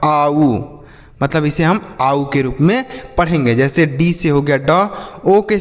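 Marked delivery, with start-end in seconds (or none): 0.0-0.6 आऊ with a gliding ow sound, drawn out for learners; OU read as आऊ. Shown as a demonstration. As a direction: model आऊ clearly as the sound of OU. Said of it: "ओयू का उच्चारण आऊ होगा". (आऊ का साफ़ उच्चारण, जैसे ow)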